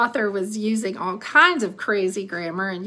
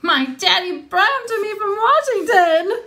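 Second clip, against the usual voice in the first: put-on high, loud child's screech; little girl screeching over a bus motor; 11 semitones higher, 5 dB louder than usual. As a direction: brag at the top of her voice. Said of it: "Maybelle was still screeching".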